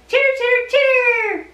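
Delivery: high squeaky chitter; chittering puppet. Pretend. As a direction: chatter like a little puppet critter. Angel puppet speaks.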